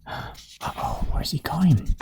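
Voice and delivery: gasp, then hushed wondering; worried whisper. Pretend. Reader watches pig run away, worried whisper.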